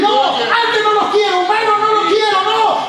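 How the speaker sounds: soaring revival preacher at full power; full-force preaching. Acted. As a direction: lift the congregation with a fiery preaching chant.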